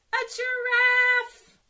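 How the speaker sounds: high, loud, slow delighted reveal; excited reveal. Acted. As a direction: unveil the surprise for the kids, drawn out. Page reveals the giraffe friend.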